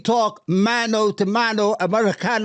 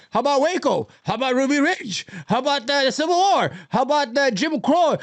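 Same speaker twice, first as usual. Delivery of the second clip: higher-pitched mimicry of a ranting caller; mock indignation; 6 semitones higher than usual. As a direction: fire off each question as a mocking imitation. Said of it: "he's like".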